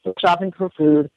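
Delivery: ordinary talk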